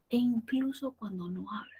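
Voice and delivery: whisper. Shown as a demonstration.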